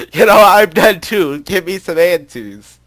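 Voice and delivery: slow, loud, fed-up cry; a character at the end of her rope, loud and slow; exasperated outburst. Acted. Reading a tired character's lines.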